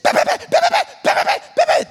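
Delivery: high breathy rapid-fire bahs; nonstop gun noise. Retold or imitated. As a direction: keep the paintball gun firing. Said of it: "that's the sound it makes".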